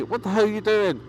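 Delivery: a mock yell at a careless driver, pitched high; helmet yell. A biker at seventy yells at the driver who cut in, high mock yell. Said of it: "yell inside my helmet".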